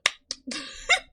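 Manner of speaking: a high breathy laugh; a quick high giggle; high unvoiced laugh. Real, not acted. Reacts to governor line.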